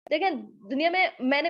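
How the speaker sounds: ordinary talk, nothing marked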